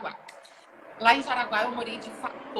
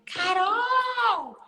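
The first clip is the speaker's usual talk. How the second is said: high call across the gym; 12 semitones higher, 5 dB louder than usual. Retold or imitated. An old friend spotting her at the gym, high calling shout. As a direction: shout her name from a distance.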